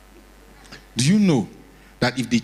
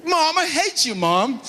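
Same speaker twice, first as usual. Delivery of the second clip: put-on bratty American kid; 13 semitones higher than usual. American kid talking back.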